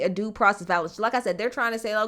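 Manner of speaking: ordinary delivery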